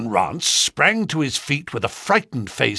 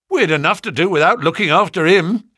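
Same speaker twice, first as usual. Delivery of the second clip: fast, louder and higher, hard done by; aggrieved; 6 semitones higher, 5 dB louder, faster than usual. A narrator's reading. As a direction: protest like a wronged constable. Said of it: "the policeman said in an aggrieved voice".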